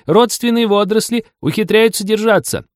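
high, loud, straining tour-guide shout; strained yelling. A narrator's reading. Tour guide on the shore outshouts the waterfall, straining. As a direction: yell over the roar of a waterfall. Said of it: "надрывно вопила стоящая на берегу экскурсовод".

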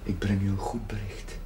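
hushed and low, a whisper; whispered news. Acted. Priest whispering good news to the grieving mother. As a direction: breathe the good news gently.

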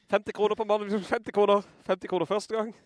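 high, loud auctioneer's call; auksjonarius. Acted. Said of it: "ikke auksjonist". (ropende auksjonarius)

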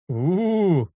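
high drawn-out ghostly wail; ghost moan. Acted. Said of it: "застонал Тим, изображая привидение".

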